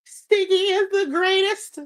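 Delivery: high squeaky defensive friend voice; mock outraged friend. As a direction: play an indignant superfan defending Stiggy, high-pitched. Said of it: "Why she get up here and be like".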